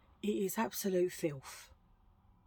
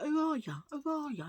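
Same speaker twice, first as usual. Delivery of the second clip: mock viewers asking; 8 semitones higher, faster than usual. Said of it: "They're like".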